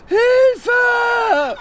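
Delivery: very high, loud scream for help; panicked shout. Acted. Covered in ants, screaming for help. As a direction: shriek it out in panic.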